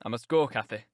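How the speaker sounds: low-pitched man's voice; Heathcliff. Acted. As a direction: say it low and firm. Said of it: "said Heathcliff".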